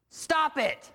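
sudden, high shouted command. Retold or imitated. Therapist snapping at his patient, high shout. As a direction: bark it like a fed-up therapist.

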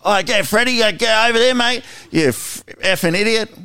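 high, loud yelling builder on site; hammed-up tradie yelling at a workmate; mock builder shout. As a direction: bark at a mate across the site, hammed up. Mock aggressive builder talk.

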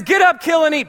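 God commands Peter, loud and high; booming command. Acted. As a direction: bark it down from heaven.

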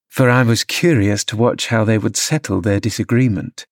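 plain reading voice

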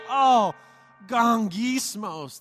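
drawn-out groaning gongismos, Oh punched loud; hammed-up grumbling groan; mock groan. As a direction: groan the word like a complaint. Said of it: "it's like".

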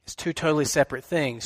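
usual speaking voice

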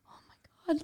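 hushed mock awe; soft, voiceless retold amazement; starstruck whisper. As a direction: whisper it as if meeting your idol. Retells meeting childhood idol, starstruck.

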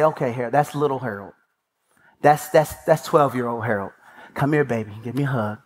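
lightly voiced wife calming her husband; soothing wife. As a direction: coax him gently like a hurt child. Wife soothing him like a child.

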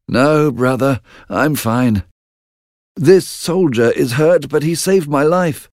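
calm his brother down; reassuring brother. Acted. Malcolm voiced.

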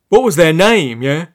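提高声音，不耐烦地追问; peevish pants. Acted. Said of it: "Now it was the pants' turn to be peevish".

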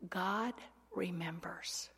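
soft whisper on remembers; whispered reveal. Real, not acted. Preacher telling women the meaning of Zechariah, soft whisper. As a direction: let it drop to a whisper. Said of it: "whispered punchline".

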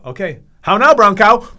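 high, booming bravado; loud showy challenge. Retold or imitated. Storyteller about to staple his own leg, loud showy taunt. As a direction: announce the stunt like a dare.

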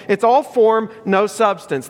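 normal talking voice

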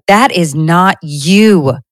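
firm, slow declaration; slowly and firmly reject the lie. Retold or imitated. Speaker rejecting an old lie, firm and slow. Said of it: "I just need to be like".

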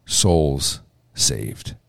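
usual speaking voice

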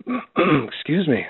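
hoarse throat clearing, choking. Real, not acted. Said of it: "choking here".

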